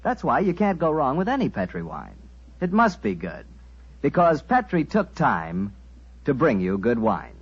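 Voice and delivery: normal talking voice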